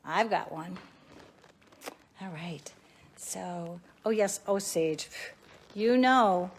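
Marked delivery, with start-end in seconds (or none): 2.2-3.8 a breathy whispered aside to herself; hushed all right. Real, not acted. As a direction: whisper it under your breath. Aside while moving around.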